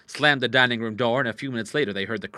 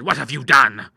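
loud, appalled question; horrified cry; 3 semitones higher, 10 dB louder than usual. Acted. Father confronting his daughter, loud and appalled. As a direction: demand it in dismay. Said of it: "he cried".

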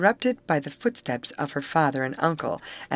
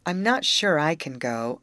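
usual speaking voice

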